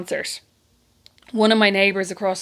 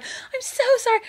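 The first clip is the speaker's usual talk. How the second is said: high squeaky frantic apology; mock squeaky sorry from behind a car; little squeaky voice; 14 semitones higher than usual. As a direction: squeak it like a caught girl. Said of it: "behind this car going".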